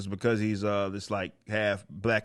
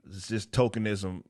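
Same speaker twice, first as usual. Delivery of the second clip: ordinary talk, nothing marked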